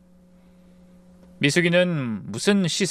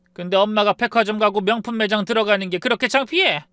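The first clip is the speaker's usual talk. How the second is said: furious scolding; 8 semitones higher than usual. Acted. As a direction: pile on the shame. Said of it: "제가 더 몰아붙였습니다".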